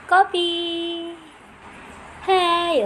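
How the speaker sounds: high, loud call; calling the dog. Real, not acted. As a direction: shout his name to get his attention.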